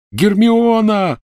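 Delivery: very high, loud, drawn-out scream; yell. A narrator's reading. Ron yelling for Hermione from the cellar. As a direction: bellow her name in anguish. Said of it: "заорал Рон".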